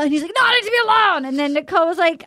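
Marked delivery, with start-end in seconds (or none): 0.4-1.2 higher, agitated retold protest; hammed-up plea to be left alone; mock upset. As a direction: protest it in a raised, put-on voice. Acted Colton storming off.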